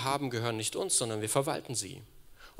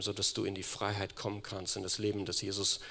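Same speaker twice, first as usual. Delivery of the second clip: lower, softer, tender close; soft closing blessing; 3 semitones lower, 5 dB quieter than usual. Real, not acted. Preacher blessing the listeners at the close, soft and tender. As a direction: bless them gently at the end.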